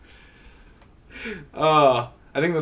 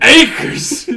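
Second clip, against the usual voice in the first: much higher, shrill mock yokel cry; high hammed-up exclamation; mock exclaim; 12 semitones higher, 9 dB louder than usual. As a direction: squeal the word out in caricature. Mocking an exclamation.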